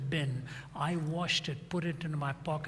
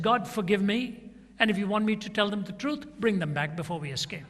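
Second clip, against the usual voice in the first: louder, pleading cry to God; desperate prayer; 4 semitones higher, 6 dB louder than usual. Retold or imitated. Prisoner crying out to God before the escape, louder and pleading. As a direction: plead out loud, half desperate.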